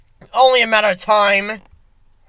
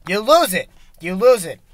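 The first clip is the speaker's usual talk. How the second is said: mock deep musing; 6 semitones lower, 3 dB quieter than usual.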